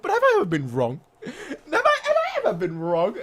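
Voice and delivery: giggling through the question; laughing, teasing repeat of the brag. Real, not acted. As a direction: tease while laughing.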